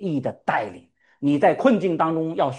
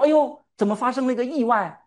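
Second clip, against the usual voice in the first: mock surprise; 9 semitones higher than usual. God imagined surprised, mocked.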